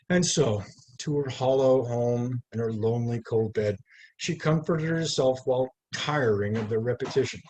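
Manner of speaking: measured, lyrical poetry reading; poem reading. Acted. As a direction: read it like a poet at an evening reading. Reads poem aloud at reading.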